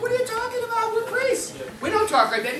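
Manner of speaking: high-pitched scandalized priest; shocked priest. Acted. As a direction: gasp it out high, like a prim priest. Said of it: "He's going".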